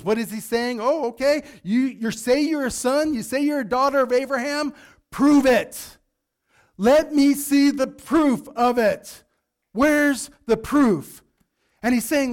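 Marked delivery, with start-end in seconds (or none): 0.8-11.2 challenging. Retold or imitated. John challenging the crowd.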